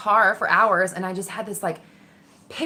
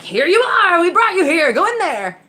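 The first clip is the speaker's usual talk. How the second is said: much higher, louder coaxing call; urging inner voice; 11 semitones higher, 8 dB louder than usual. Retold or imitated. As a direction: beckon her in eagerly. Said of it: "That voice that had been driving me".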